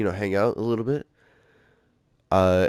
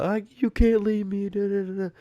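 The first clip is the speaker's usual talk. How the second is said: high, pleading mock-girlfriend voice; crying girlfriend; 12 semitones higher than usual. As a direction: whine it like a clingy girlfriend begging. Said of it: "she starts, like, crying and stuff".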